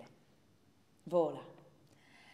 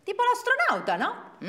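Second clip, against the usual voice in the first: put-on cheeky child; 14 semitones higher, 7 dB louder than usual.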